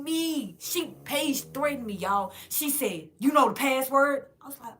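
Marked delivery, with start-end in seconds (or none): 3.2-4.3 loud, high imitation of a challenge; much louder, raised-pitch retold demand; confrontational challenge. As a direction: throw the question out loudly, mocking her. Retells Paige threatening her.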